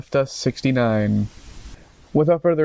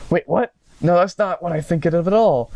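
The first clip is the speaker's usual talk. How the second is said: higher, flustered denial; embarrassed; 5 semitones higher than usual. Acted. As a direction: deny it, caught off guard.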